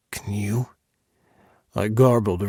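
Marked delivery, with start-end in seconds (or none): 0.1-0.7 breathy whisper right at the ear; a lover's soft whisper in the ear. Acted. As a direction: whisper it close and dirty.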